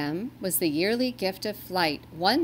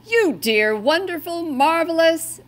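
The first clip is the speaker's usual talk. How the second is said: much louder, higher overjoyed praise; Santa exclaiming; 10 semitones higher, 10 dB louder than usual. A narrator's reading. Santa thanks the rescuing reindeer, loud overjoyed exclaim. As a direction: gush with relief and delight. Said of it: "exclaimed Santa".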